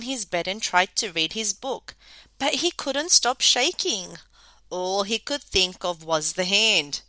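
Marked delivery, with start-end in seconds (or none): none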